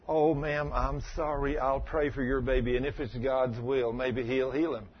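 soft, sympathetic bedside voice; mock gentle comfort. Preacher mocking soft bedside comfort he rejects. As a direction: say it with limp, pitying sympathy. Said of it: "it's not saying".

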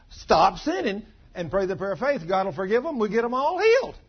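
loud, rising to high; preaching at full force. Real, not acted. Preacher promising healing to the congregation, loud and building. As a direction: build it to a triumphant cry.

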